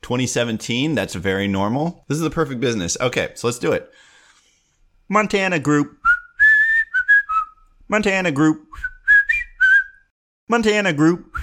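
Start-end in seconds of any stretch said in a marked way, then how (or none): 5.1-11.4 high Muppet-style sing-song call; repeated high silly Muppet chant. Pretend. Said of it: "Whistle a normal business in a Muppet voice".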